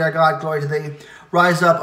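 usual speaking voice

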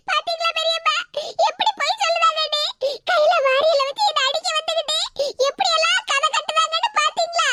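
high, sobbing put-on complaint; hammed-up weepy accusation; fake tearful tattling. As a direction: whine through crocodile tears. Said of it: "நீ அழுகை நிப்பாட்டு".

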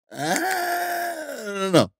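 slow high wailing cry; drawn-out wail. Pretend. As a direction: stretch it into a mournful wail.

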